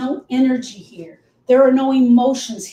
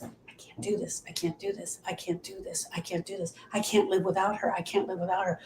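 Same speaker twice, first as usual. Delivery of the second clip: very soft, near-whispered inner voice; hushed, repetitive self-talk; whispering thoughts; 3 semitones lower, 11 dB quieter than usual. Acted. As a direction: murmur it on repeat like a thought in the back of the brain. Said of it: "they're whispering in the back of your brain".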